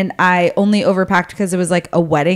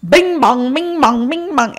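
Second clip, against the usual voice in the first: mock doorbell singsong; 6 semitones higher, slower than usual. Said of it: "Mom's over here just like".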